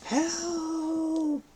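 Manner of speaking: high desperate cry for help. A narrator's reading. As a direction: call out for help in panic. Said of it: "and cried".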